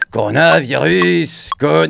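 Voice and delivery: slow, lightly voiced [name] singing; [name] impression. Shown as a demonstration. A music vlogger sings a [name] line, slow croon. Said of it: "pour ceux qui n'ont pas reconnu, c'était [name]".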